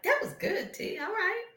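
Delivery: high, soft pleased voice; a hint of smug self-praise; self-congratulating. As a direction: whisper a pleased pat on her own back. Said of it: "I was like".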